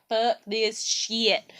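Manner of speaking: very slow, drawn-out retold quote; fed-up drawl. Recalling the day she gave up on everybody, slow and fed-up. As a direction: stretch each word, utterly done.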